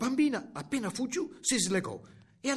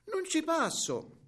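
put-on high, drawn-out child voice; child's protest; 13 semitones higher, 3 dB louder, slower than usual. A narrator's reading. Girl to grandmother about not getting through, high protest.

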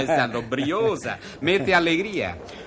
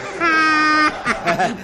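loud forced laugh; 10 semitones higher, 4 dB louder than usual. Acted. Host laughs at own joke.

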